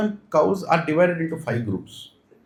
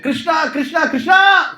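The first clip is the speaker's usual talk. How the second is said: calling out; 10 semitones higher, 4 dB louder, slower than usual. Retold or imitated. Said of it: "the Gopas reach ... and like".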